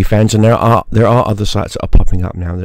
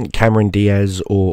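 ordinary talk

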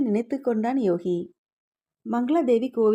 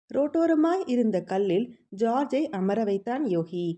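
plain reading voice

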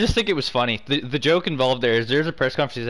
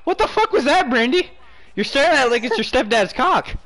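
frustrated yelling; 8 semitones higher than usual. Real, not acted. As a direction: yell at your teammate who won't shoot. Yelling at teammate in game.

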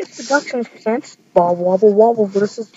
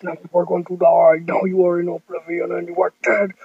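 lower, fast, bossy kid's bad-guy voice; Jesus villain voice; 8 semitones lower than usual. Jesus threatening Bob Wobble Wobble before firing his beam. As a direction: growl it down low like a toy villain.

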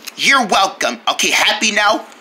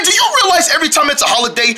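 ordinary talk, nothing marked

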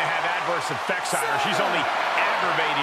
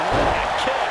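high, rising cry of awe; excited call; 8 semitones higher, 3 dB louder than usual. Real, not acted. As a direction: shout it up in amazement. Commentator calls a big kick.

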